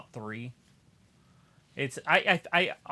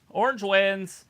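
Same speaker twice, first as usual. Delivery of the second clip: loud, high announcer call; excited announcement; 6 semitones higher, 10 dB louder, slower than usual. Real, not acted. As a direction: announce the winner. Announcing the match result.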